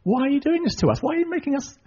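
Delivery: high-pitched mock complaint; grumbling. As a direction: complain in a put-on high whine. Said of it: "They start to grumble at Moses and Aaron".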